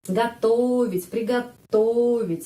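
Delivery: готовить and приготовить said slowly, o reduced to a; teacher modelling готовить; slow careful Russian. Shown as a demonstration. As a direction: spell out the stress slowly for learners. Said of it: "penekanannya berada pada huruf O".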